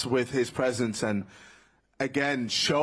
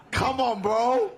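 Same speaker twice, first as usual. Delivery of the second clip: excited laugh; 12 semitones higher, 4 dB louder than usual. Real, not acted. Hyping fighter, laughing.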